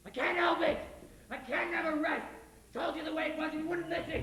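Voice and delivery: anguished man shouting his obsession; desperate yell. Acted. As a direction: cry it out at the top of his voice. Judd's desperate outburst.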